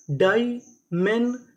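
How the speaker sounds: loud, very slow "dimensional", each part apart; syllable by syllable. Shown as a demonstration. Teacher assembles the word for learners, slowly part by part. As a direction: break "dimensional" into separate beats with pauses.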